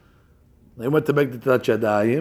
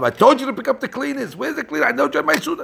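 mock nagging husband; 11 semitones higher than usual. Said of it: "you told your wife".

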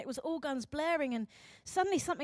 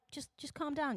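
softer, placating aside; calming; 7 dB quieter than usual. Acted. Society damping down those who speak out, softly placating. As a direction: lightly hush them.

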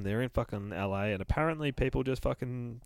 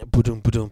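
thumping noise; 11 dB louder, faster than usual. Retold or imitated. Mimics CPR chest thumps.

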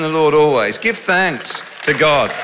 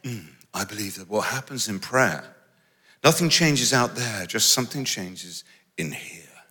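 soft, lowered mock-sophisticated tone; mock cool dismissal; 4 semitones lower, 7 dB quieter than usual. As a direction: parody the trendy skeptic. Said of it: "cool in certain circles to say".

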